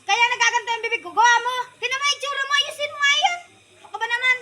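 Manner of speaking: loud, high angry scolding. Acted. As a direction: tell him off loudly. Wife scolding drunk husband.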